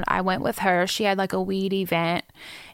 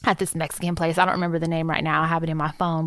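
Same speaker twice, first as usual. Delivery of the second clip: ordinary talk, nothing marked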